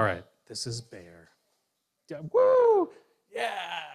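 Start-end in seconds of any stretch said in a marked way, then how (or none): 2.1-3.8 excited cheer. Acted. Bear cheers as toy.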